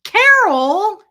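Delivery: loud, high, drawn-out shout of the name; calling out. Real, not acted. Streamer hailing Carol for a super sticker, calling out loudly. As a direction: belt out the name in greeting.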